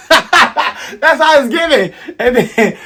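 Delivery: talking through high, loud laughter; real laughing. Real, not acted. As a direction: crack up while trying to carry on. Cracks up at own example.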